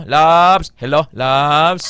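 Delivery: slow, tender phone hello to a loved one; slow sweet hello to a lover. Shown as a demonstration. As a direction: coo it to your sweetheart. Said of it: "plangga mo".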